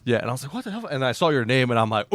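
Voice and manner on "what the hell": high, groggy startled question; just woken and baffled. Retold or imitated.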